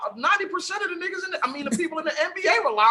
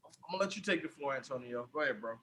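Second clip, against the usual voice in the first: much softer and lower, handing off; quiet aside; 12 semitones lower, 17 dB quieter than usual. Real, not acted. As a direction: murmur it off-mic. Handing floor over quietly.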